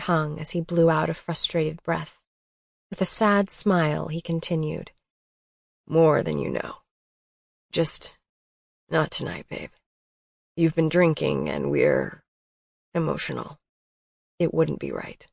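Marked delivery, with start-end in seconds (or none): 5.9-15.2 a hint of a lower, tender man's voice; lightly voiced Colton. A narrator's reading. Colton turning her down kindly, lightly lower voice. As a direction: gently refuse her, low.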